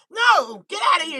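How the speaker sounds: high shout shooing the dog; mock angry yell. Owner shooing the dog away, mock shouting.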